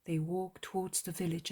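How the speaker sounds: usual speaking voice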